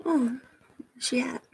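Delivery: soft whisper to herself while searching. Real, not acted. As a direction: breathe it under your breath, half to yourself. Muttering while searching the chat.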